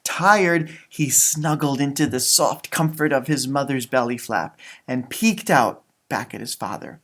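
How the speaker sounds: gentle read-aloud storytelling voice; story narration. Acted. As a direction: read it like a bedtime picture book. Reading picture book aloud.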